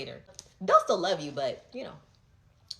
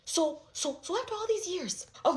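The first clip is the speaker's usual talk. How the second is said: incredulous; 8 semitones higher, 3 dB louder than usual. Real, not acted.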